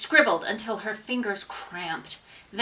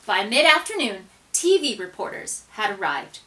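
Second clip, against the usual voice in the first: ordinary delivery, nothing marked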